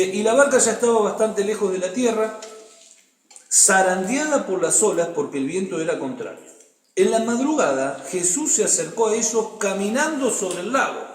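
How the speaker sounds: animated reading of the Gospel; scripture reading. Acted. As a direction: read the passage out like a story. Reads the Bible passage aloud.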